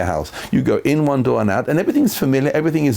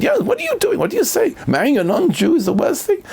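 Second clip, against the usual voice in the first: much higher, indignant mock yell; a hammed-up outraged cry, pitched way up; retold scream. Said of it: "he screamed at me".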